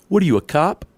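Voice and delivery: mock the driver's comeback; rude truck driver. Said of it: "and he says".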